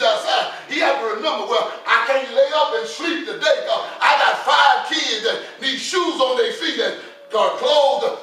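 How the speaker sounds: loud, impassioned pulpit delivery; full-force preaching. Acted. Preacher retelling daddy working for five kids, full-force preaching. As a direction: voice a weary father's resolve at preaching volume.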